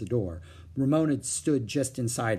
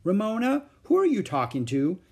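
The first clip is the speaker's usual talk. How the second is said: mother calling out; 9 semitones higher, 4 dB louder than usual. A narrator's reading.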